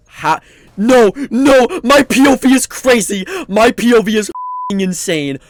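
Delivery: loud, high panic about the view; panicked shouting. Real, not acted. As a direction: shout it in wild disbelief. Panicked reaction to glitch.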